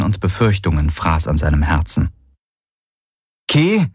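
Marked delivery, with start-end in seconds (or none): 3.5-3.9 calling after her. Acted. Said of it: "rief er ihr nach".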